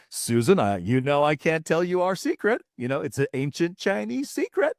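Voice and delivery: Mister Lee. Retold or imitated. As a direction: confide a trade secret, mock-commercial style. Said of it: "regular straight American accent".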